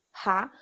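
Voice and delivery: "Ra" with an aspirated R, like an English "ha"; rhotic R sound. Shown as a demonstration. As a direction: say "Ra" with the R as an H from the throat. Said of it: "Tem o R rótico".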